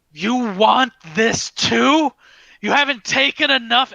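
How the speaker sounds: top-of-the-lungs furious shout, high-pitched; screaming in rage. Acted. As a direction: scream it, beside yourself with anger. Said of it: "he's screaming at the top of his lungs".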